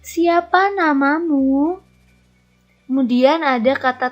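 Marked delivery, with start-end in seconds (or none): 0.0-1.8 slow, higher "Siapa namamu" with a rising end; question intonation. Shown as a demonstration. Teacher models asking someone's name, question intonation. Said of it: "intonasi dalam kalimat tanya berbeda".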